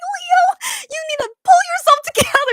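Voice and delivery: high, loud mock scolding of a tripping actor; scolding in my head. Fan scolding the tired actor in her head, high mock scold. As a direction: tell him off, half laughing, to pull himself together. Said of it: "that's what I was thinking in my head".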